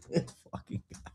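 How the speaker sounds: breathy whisper of disbelief. Real, not acted. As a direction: whisper it, stunned.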